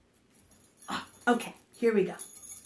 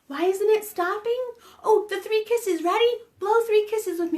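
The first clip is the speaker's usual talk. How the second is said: high, loud mock panic; urgent, the pot won't stop; alarmed; 9 semitones higher, 7 dB louder than usual. Telling the kids in mock panic to blow kisses.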